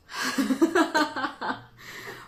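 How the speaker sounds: high burst of laughter; teasing laugh. Real, not acted. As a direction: laugh after ribbing a friend. Laughs at teasing her friend.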